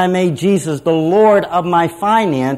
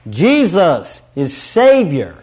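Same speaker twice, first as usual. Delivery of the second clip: triumphant declaration; 3 dB louder, slower than usual. Retold or imitated. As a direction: proclaim it like a crowd rejoicing. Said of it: "will join their voices triumphantly".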